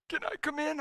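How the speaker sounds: high, small imitated son's voice; tearful son. Sickly son answers his dad, imitated tearful voice. Crying son asks to enter.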